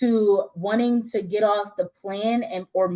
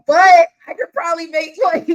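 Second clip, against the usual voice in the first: excited protest; 9 semitones higher, 10 dB louder than usual. Retold or imitated. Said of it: "And I was like".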